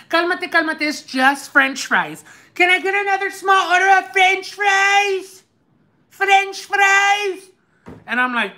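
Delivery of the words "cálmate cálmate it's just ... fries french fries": high mocking shush, slowing on french fries; mimicking the worker. Worker hushing the upset customer, high dismissive imitation. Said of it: "She told me like that".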